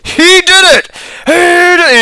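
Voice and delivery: high, loud accusing yell; frantic accusation. Acted. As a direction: point and scream it out. NPC accusing loudly.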